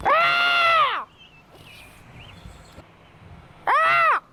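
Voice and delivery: high drawn-out scream of fright; high frightened scream; long scream. Real, not acted. Screams in the game.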